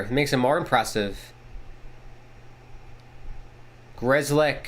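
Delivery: steady broadcast call of the action; play-by-play. Acted. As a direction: call the game like a TV announcer.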